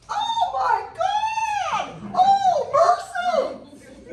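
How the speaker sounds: very high, very loud, slow drawn-out cry; high shouted Oh my God and mercy; shocked cry. Real, not acted. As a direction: let the shock burst out in a shout. Reacting to the temperature check.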